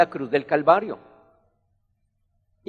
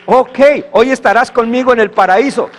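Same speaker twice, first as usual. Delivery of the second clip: loud, higher, ringing promise; Jesus' promise; 5 semitones higher, 7 dB louder than usual. Acted. Jesus promising the repentant thief paradise, loud and higher. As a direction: proclaim it with joy from the cross.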